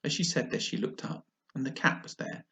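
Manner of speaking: ordinary delivery, nothing marked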